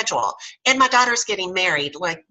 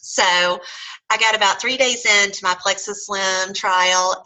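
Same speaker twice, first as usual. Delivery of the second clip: ordinary delivery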